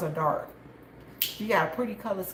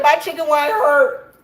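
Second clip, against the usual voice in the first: higher, louder mock complaint; mock whine; 7 semitones higher, 9 dB louder than usual. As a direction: whine it like the hurt friend. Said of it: "Melissa said".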